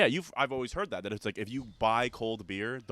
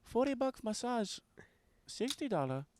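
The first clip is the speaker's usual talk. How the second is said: higher, softer voice in a put-on Mexican accent; Mexican accent impression; 9 semitones higher, 4 dB quieter than usual. Retelling a Mexican coworker's massage parlor pitch, in a put-on Mexican accent. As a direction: quote the coworker's broken English pitch, higher than your own. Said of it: "He goes, he goes".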